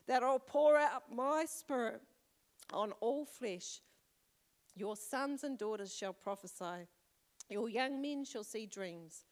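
slow, weighty reading of the verse; preacher reading the prophecy to the church, slow and solemn; solemn scripture reading. Acted.

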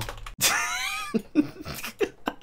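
long, very high wheezing laugh; wheezing laughter. Real, not acted. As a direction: laugh helplessly until only squeals remain. Laughs after reaching safety.

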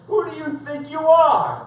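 loud, high-pitched acted outrage; dramatized indignation from the pulpit; indignant challenge. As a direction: throw it down as a crowd's challenge. Voices crowd challenging Jesus.

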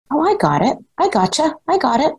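sudden realization. Retold or imitated. Boss conceding to new employee, lightly voiced sudden realization. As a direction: play the boss suddenly getting it, a touch surprised.

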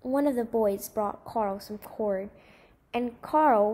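ordinary delivery

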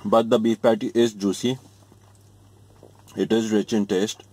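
talking between chews; mouth full, chewing. Real, not acted. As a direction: food in mouth. Seen 1: Chewing after bite at -7; lips pressed, mouth working mid-sentence.

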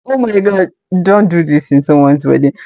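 normal talking voice

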